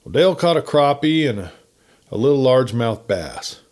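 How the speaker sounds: ordinary delivery, nothing marked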